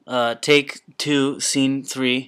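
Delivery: slow loud slate call; loud film set calls. Pretend. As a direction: call the take like a director. Slating a take, film set.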